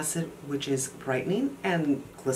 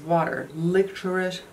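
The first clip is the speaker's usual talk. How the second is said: thick, clumsy tongue; words slurred by a swollen tongue. Real, not acted. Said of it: "I don't know what's wrong with my mouth today".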